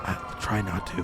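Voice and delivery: soft breathy whisper, barely voiced; whispering on the phone. Real, not acted. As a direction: whisper so nobody hears. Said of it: "Why are you whispering?".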